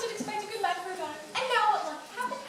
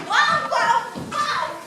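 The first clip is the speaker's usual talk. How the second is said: mock baby scream; 4 semitones higher, 8 dB louder, much slower than usual. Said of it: "the fellow be screaming".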